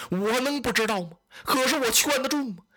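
tearful protest. Acted. Old general Cheng Pu answering the doctors, crying out in distress. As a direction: voice breaking into tears, rising high.

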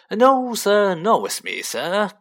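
high, loud fawning shopkeeper; servile agreement. A narrator's reading. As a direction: eagerly echo your better to please him. Shopkeeper hastily agrees with haughty customer.

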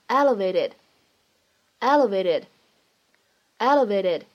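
"elevated" with the t softened to a quick d-like tap; American flap T. Shown as a demonstration. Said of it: "在美式发音当中会有一个闪音".

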